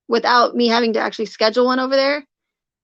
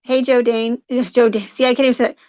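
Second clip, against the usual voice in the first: ordinary talk, nothing marked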